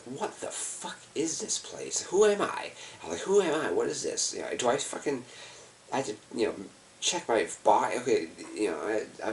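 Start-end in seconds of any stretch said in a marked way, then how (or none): 0.0-4.3 stunned whisper-ish question building to a cry; disoriented panic. Retold or imitated. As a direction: retell waking up lost, rising into alarm.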